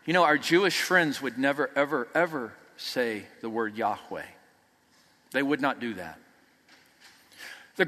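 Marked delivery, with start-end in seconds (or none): 0.0-6.2 1 hushed reverence. Real, not acted. Reverent hush about the name.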